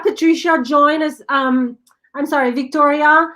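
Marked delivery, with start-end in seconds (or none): none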